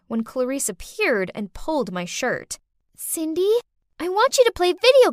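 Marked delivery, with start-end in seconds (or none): 3.0-5.1 put-on high-pitched little girl voice; pleading child. A narrator's reading. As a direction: plead as a young child wanting a playmate. Child begs to play in story.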